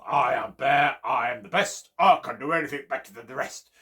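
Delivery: loud chanted brag; bear's boasting song. Acted. Bear singing his boast in the forest, loud. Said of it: "as he was singing the song".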